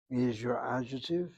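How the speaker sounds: ordinary talk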